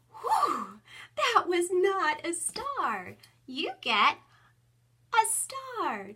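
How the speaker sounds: high, loud, slow "that was not a star" with a sigh of relief; relieved then delighted. Shown as a demonstration. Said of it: "and I come back".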